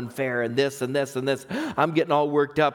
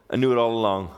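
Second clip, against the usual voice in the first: ordinary talk, nothing marked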